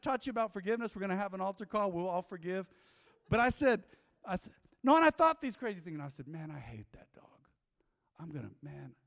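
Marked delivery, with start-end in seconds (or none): 6.3-8.9 hushed, voiceless whisper. Retold or imitated. Pastor confessing his dark thoughts to the church, whispered. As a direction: whisper it like a guilty secret.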